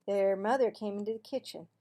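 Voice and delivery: ordinary delivery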